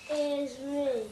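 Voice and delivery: ordinary talk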